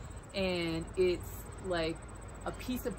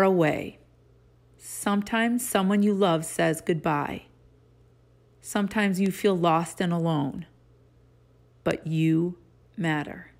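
reading aloud; 5 dB louder than usual. Acted. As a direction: read each line gently with pauses. Reads picture book aloud.

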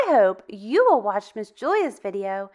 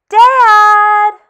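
calling out; 8 semitones higher, 15 dB louder than usual. Acted. Scared child calling his mother, loud and high. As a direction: shout Mom much higher and louder. Child calls parents at night.

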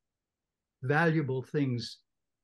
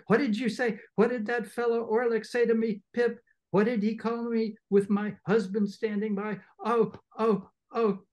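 high, loud theatrical shrieks; shrieking; 8 semitones higher, 4 dB louder than usual. Acted. Sister shrieking at Pip over Orlick's insult. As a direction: shriek for the husband to act. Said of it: "Each of these exclamations was a shriek".

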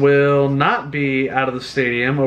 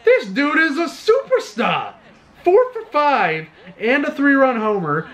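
excited; 7 semitones higher than usual. Real, not acted. Teammate hits a three-run homer.

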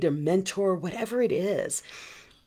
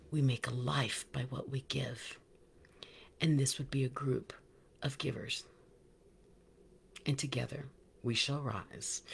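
softer, half whispered, sincere; hushed; 4 semitones lower, 9 dB quieter than usual. Real, not acted. Host closing her message to the tribe, hushed. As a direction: drop to an earnest hush.

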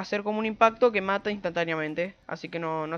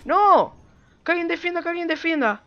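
panicking gamer; 6 semitones higher, 6 dB louder, much slower than usual. Real, not acted. Hammer being stolen, calls team.

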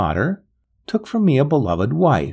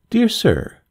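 letter read in Gervais' voice; 11 semitones higher than usual. Acted. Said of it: "Mister Paul Wade".

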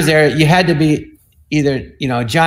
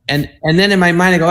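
usual speaking voice

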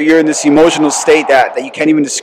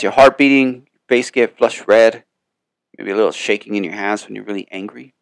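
ordinary talk

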